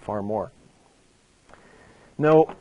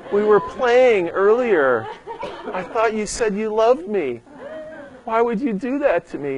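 put-on hurt little boy; 9 semitones higher than usual. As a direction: mimic a betrayed three-year-old, half laughing. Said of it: "he just looked at me like".

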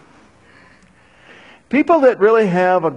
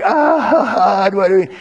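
whining Moses; 3 semitones higher, 5 dB louder than usual. Retold or imitated. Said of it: "Moses is whining too".

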